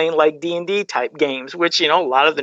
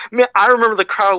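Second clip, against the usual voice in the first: usual speaking voice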